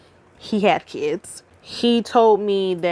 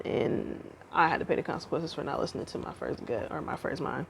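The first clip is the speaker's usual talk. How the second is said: quiet; 4 semitones lower, 12 dB quieter than usual. Real, not acted. Regretful confession trailing off.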